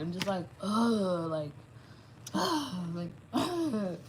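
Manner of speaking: drawn-out fed-up ugh with a sigh; annoyed groans. Real, not acted. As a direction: groan out your irritation, slowly.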